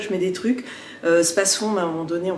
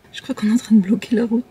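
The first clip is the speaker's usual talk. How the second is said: whispering; faster than usual. Real, not acted. Voiture bloque la route.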